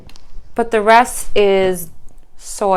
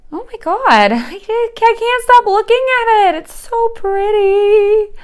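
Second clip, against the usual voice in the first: excited squealing; 12 semitones higher, 6 dB louder than usual. Real, not acted. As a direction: squeal over the plant. Can't stop looking at it.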